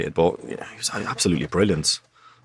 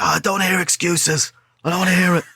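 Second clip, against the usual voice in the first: Bobby Saxton; 11 semitones higher than usual. Retold or imitated. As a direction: imitate a manager shouting down excuses. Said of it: "his voice, this was his voice".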